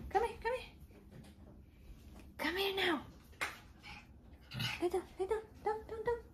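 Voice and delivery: high pleading coo. Real, not acted. Owner coaxing the restless dog to lie down, high pleading. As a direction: coax the puppy down, pleading.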